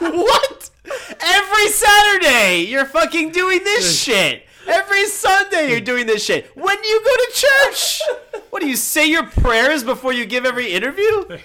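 high incredulous rant through laughter; laughing disbelief. Retold or imitated. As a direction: laugh out the outrage. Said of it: "you're like".

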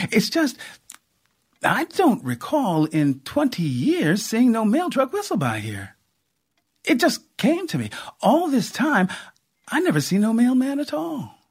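lightly voiced country aunt piecing it together; a hint of a puzzled old aunt; Aunt Cora, puzzled.

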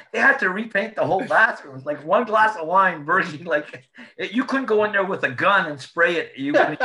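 gleeful disbelief, barely holding in laughs; laughing. Real, not acted. Marvelling at the wine-splattered bathroom, laughing high and loud.

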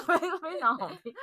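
mocking the co-host through laughter; laughing mockery. Real, not acted. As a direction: laugh while parroting the plug. Mocking partner's plug, laughing.

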